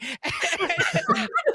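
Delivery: shrieking high laugh; big high laugh. Real, not acted. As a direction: cackle with delight. Laughing at the prank.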